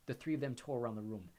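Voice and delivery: plain reading voice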